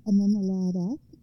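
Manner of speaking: plain reading voice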